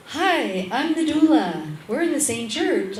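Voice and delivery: high, loud chirpy greeting; perky doula at the door; bright greeting. Retold or imitated. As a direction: greet her like an eager newcomer.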